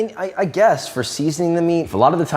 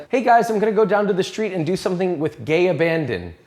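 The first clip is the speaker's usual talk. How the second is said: higher-pitched, hammed-up American accent; 4 semitones higher than usual. Shown as a demonstration. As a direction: put on a cheery American greeting voice. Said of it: "an American accent".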